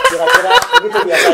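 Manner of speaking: high laughing relief. Real, not acted. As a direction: laugh through the words. Laughing after finishing announcement.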